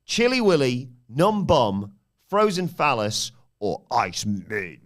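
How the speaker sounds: loud, dramatic game-show read; quiz host voice. Acted. Quiz host rereads question.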